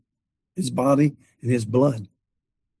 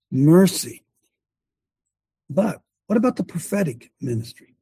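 normal talking voice

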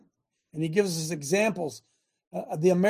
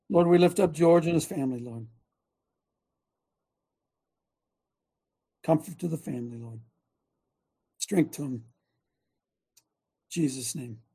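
prayer; 6 semitones lower than usual. Real, not acted. Prays for grieving family.

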